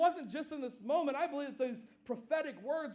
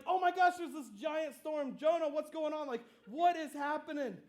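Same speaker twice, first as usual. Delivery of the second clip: raised, mock-panicked outcry; freaking out; 4 semitones higher, 3 dB louder than usual. Sailors question Jonah in a giant storm, freaking out. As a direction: act out alarmed sailors in a storm. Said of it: "start to freak out".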